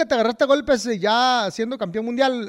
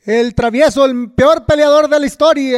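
teasing, loud sportscaster voice; mock fight commentator; 2 semitones higher, 6 dB louder than usual. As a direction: call it out like a ringside commentator. Mock commentary interrupts the story.

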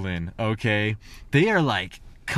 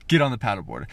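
usual speaking voice

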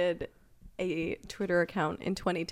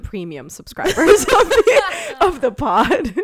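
laughing; 7 semitones higher, 12 dB louder than usual. Real, not acted. Mock-grand premium subscribers joke.

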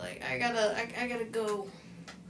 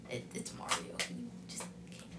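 hushed whisper; 10 dB quieter than usual. Real, not acted. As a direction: whisper it like a secret. Hushed aside about Mario.

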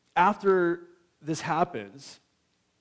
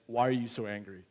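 softer, lower, gentle question; caring father; 5 semitones lower, 6 dB quieter than usual. Acted. God approaching Cain gently, soft and tender. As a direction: ask it tenderly, like a worried father.

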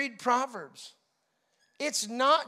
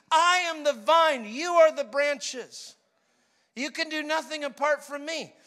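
preaching scripture; 4 semitones higher, 6 dB louder than usual. Acted. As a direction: proclaim each phrase to the congregation.

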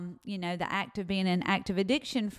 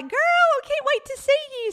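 much higher and louder, thrilled squeal; squealing girls; 8 dB louder than usual. Retold or imitated. Recovery girls greeting her on the phone, squealing. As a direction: squeal it with delight.

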